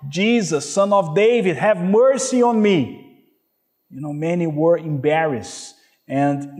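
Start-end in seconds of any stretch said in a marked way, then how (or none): 0.1-3.1 raised, pleading cry for mercy; beggar crying out. Retold or imitated. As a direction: call out to Jesus like the blind beggar begging. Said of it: "when the blind beggar cried out".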